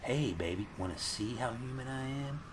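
soft, hushed sleazy come-on; mock seductive. Horny man hitting on fleeing woman, mock seductive. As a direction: croon it quietly as a pickup line. Mocking the horny pickup line.

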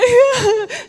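high, loud imitated bawl; mock crying wail. Preacher imitating her burst of tears, mock bawling. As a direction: burst into hammed-up sobs. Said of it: "I burst into more tears".

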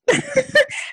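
high burst of embarrassed laughter; a sudden high laugh at herself; real laugh. Real, not acted. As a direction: laugh it off, pitch jumping up. Laughs at her own fear.

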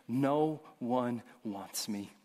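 slow, soft dejected line; mild hopeless murmur. Retold or imitated. Ashamed woman at the well, slow and soft. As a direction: let it sink, head hung. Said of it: "as she hangs her head saying".

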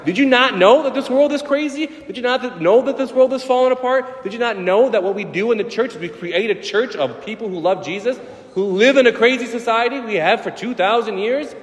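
exuberant retort. Retold or imitated. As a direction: brush off the worry with cheerful fire. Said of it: "I was like".

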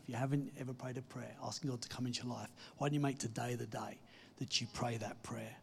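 usual speaking voice

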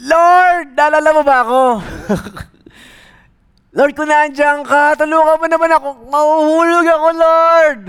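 high, loud pleading cry; desperate plea while hanging; desperate calling. Retold or imitated. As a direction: beg God at the top of your voice. Jogger cries to God.

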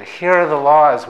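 a hammed-up Brooklyn accent; Feynman Brooklyn accent. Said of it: "I wish I could do a Feynman Brooklyn accent ... I'll try to fake it".

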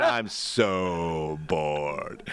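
very slow, stretched so bored; drawn-out bored. Retold or imitated. Mocking the driver's text with a long drawn-out moan. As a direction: stretch every O as long as you can. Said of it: "three thousand O's".

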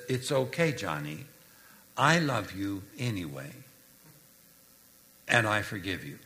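gentle comfort. Acted. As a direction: comfort a crying child tenderly. Mom comforting crying son.

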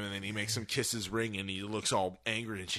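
normal talking voice

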